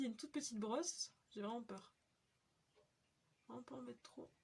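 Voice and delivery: ordinary delivery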